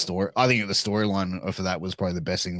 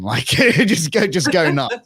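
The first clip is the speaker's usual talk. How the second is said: laughing go nuts; 7 semitones higher, 8 dB louder, slower than usual. Retold or imitated. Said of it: "she's like".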